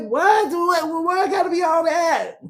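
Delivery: very high mock-incredulous squeal; incredulous woman. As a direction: act shocked she's being treated well, high and shouted. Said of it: "It's like".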